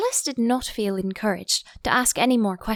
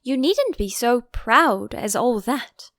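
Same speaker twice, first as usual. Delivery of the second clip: scolding Gryphon; 4 semitones higher, 5 dB louder, slower than usual. Acted.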